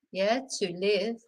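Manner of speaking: normal talking voice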